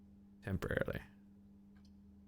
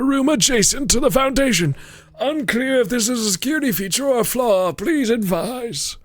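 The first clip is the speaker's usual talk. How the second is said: raised reading voice; high character voice; 12 semitones higher than usual. Acted. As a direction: read it in a bright high character voice.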